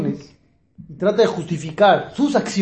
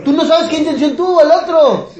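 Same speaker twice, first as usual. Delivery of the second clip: laughing through the line, higher and louder; 7 semitones higher, 5 dB louder than usual. Real, not acted. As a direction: let the laugh carry the words. Laughing at the stepping example.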